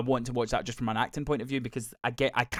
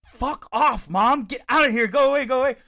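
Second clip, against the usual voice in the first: high, loud imitation of an embarrassed son; mock teenage outburst; 11 semitones higher, 10 dB louder than usual. Embarrassed son shooing mum from his friends, mock angry yell.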